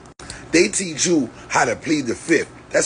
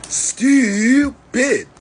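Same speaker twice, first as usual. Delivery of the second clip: a high, louder sneering "Stupid."; scornful jab; 10 semitones higher, 4 dB louder than usual. Real, not acted. Mocking the listener who asked, a scornful high sneer. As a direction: spit the word out with contempt. Scornful one-word verdict.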